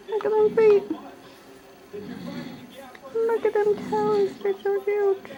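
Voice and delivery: mother cooing high to her newborn about his feet. Real, not acted. Cooing over baby's feet.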